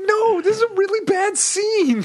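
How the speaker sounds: high and squeaky through laughter; laughing protest. Retold or imitated. Telling off someone laughing at a sad scene, squeaky laughing voice. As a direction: try to be serious but crack up.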